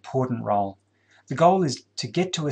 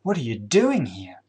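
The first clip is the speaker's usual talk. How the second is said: slower, with "doing" stretched and raised; stress on doing; 2 semitones lower than usual. Shown as a demonstration. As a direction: lean long and hard on "doing". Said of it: "place emphasis on any one of those words".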